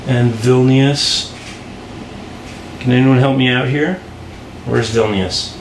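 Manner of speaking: usual speaking voice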